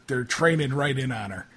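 usual speaking voice

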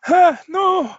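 high panicked yelp; startled cry. Acted. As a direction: cry out in alarm. GM voices fleeing German soldier.

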